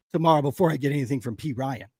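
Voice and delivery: usual speaking voice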